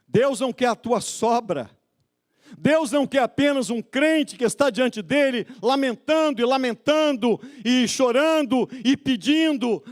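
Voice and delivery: high, insistent pulpit cadence; full-force preaching. Real, not acted. Preacher rebuking lamenting believers, full-force preaching. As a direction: pile up the list like a preacher.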